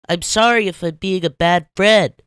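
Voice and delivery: high, loud, sighing apology; sighing SpongeBob. Acted. SpongeBob sighing to Patrick, high loud sorry. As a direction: sigh out the apology. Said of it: "the chubbier man sighed".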